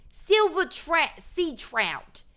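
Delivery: drawn-out, high British attempt drifting Australian; exaggerated, slow Australian-tinged British accent. Shown as a demonstration. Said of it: "I think it was Australian".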